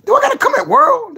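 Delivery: high loud playful challenge; high mock challenge. Real, not acted. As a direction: call him out shrilly. Mock threat.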